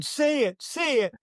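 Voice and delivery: very high-pitched egging-on; retold urging. Announcer egging on his partner at the mic, urgent. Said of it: "I'm going".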